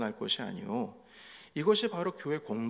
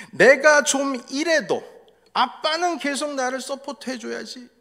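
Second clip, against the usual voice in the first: put-on teen son's voice; 12 semitones higher, 11 dB louder than usual. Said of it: "그러자 아들이 말합니다".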